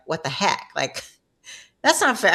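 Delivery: rising into an indignant protest; a mock-outraged cry at the unfairness; half-joking protest at her mother; retold outrage. Outraged at mom's early death.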